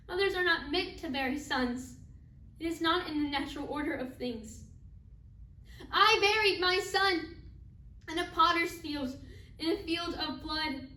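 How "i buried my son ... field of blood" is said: raised, insistent grieving declaration; a bereaved mother forcing out each hard fact; louder, emphatic stage lament; anguished grief. Acted. Acted mother recounts burying son alone.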